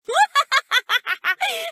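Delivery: high, loud ticklish cackle. Acted. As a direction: break into a helpless witchy cackle. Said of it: "you're going to make me cackle".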